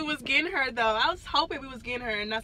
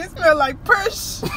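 mock quote; 6 semitones higher, 7 dB louder, slower than usual. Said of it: "She said".

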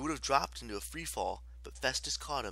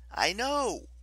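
high, raised-voice reply; urgent retort; 11 semitones higher, 5 dB louder than usual. A narrator's reading. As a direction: cut off the warning impatiently.

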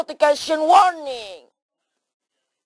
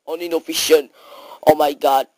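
ordinary talk

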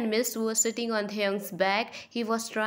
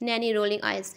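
ordinary delivery, nothing marked

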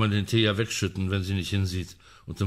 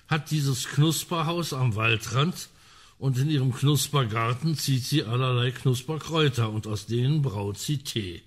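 ordinary talk

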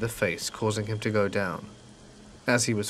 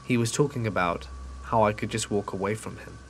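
plain reading voice

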